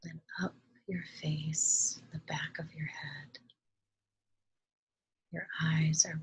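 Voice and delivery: calm, soft meditation voice with long pauses; unhurried, hushed instructions, leaving space to breathe; guided meditation. Acted. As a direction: gently guide listeners through relaxing each body part.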